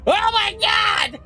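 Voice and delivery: very high-pitched hysterical scream, held back in volume; imitating a shrieking, wailing caller; mock yelling. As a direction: scream in panic to the police operator. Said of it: "why are you yelling at the operator?".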